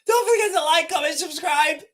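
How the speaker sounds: high, tearful with laughter; laughing through words. Real, not acted. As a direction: squeeze out the sign-off while cracking up. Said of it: "I shouldn't be laughing".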